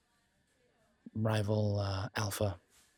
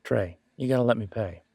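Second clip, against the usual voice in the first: ordinary delivery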